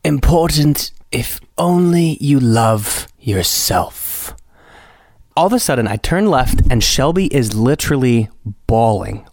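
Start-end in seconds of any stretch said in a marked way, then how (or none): 0.0-4.3 slow, higher mock guru delivery; mock profundity. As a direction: draw it out like cringy spiritual wisdom. Mocking the cringy speech about love.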